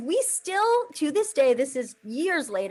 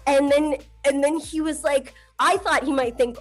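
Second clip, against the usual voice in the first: usual speaking voice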